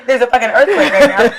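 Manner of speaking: high, loud laughing alarm; telling the hostess about the quake in mock alarm; retold alarm. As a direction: insist on the earthquake, laughing. Retelling her panic to hostess.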